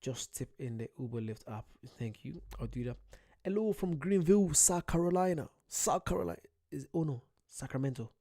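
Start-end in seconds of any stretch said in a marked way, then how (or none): 3.4-6.4 loud, higher-pitched shout-out; excited greeting. Real, not acted. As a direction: call out the viewer's hometown with gusto. Reading out a viewer's greeting.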